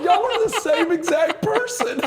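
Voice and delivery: high laughing quote, slow. Retold or imitated. Telling the Undertaker through laughter. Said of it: "I said".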